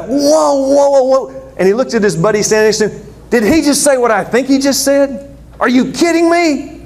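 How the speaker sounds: high, outraged disbelief; shocked listener. Acted. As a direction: squeal it like you can't believe your ears. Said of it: "And he's like".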